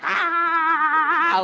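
long, high, loud "aah"; mock wild kid scream. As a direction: screech like a wild little kid. Imitates wild child spinning wheels.

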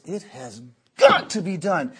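"got" punched after a pause; emphatic order. Retold or imitated. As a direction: hammer "got" as an absolute command. Dad's order applied to believers.

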